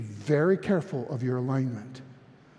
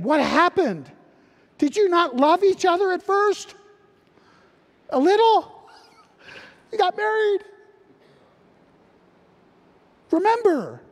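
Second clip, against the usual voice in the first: pleading cry; 7 dB louder than usual. Retold or imitated. Pleading with married couple.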